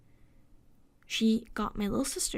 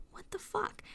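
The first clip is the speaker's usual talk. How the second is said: stunned; 11 semitones higher, 8 dB quieter than usual. Retold or imitated. Shocked reaction in the store.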